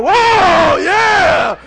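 loud, high "whoa, yeah"; big hollered cheer; excited whooping. Acted. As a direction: whoop like you're leaping out of the boat. Imagined disciple jumping out, excited.

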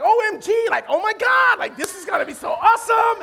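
high-pitched mock girly gushing; excited young women. Comedian mimics girls boarding the speedboat, excited young women. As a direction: squeal it like thrilled twenty-somethings, hammed up. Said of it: "some Caucasian, um, females in the front".

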